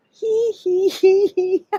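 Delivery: high slow hee hee giggle; giggling. Shown as a demonstration. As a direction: giggle it out slowly. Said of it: "laughing as you go".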